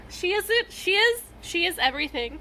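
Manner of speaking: high, loud squeal of delight; gushing. Real, not acted. Fan gushing over Eileen, loud and high. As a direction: rave about her, thrilled.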